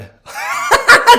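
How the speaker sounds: loud, high burst of real laughter. Real, not acted. Doctor seeing his beardless filter face, bursting out laughing. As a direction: crack up at the filter. Reacting to a funny Snapchat filter.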